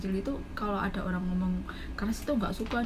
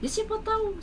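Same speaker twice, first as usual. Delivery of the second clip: mock boss doubt. Boss voiced doubting her.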